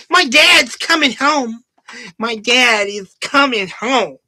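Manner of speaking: slow, high, childish mock whine; lightly voiced petulant child; mocking the dialogue with a whiny kid voice. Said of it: "He says".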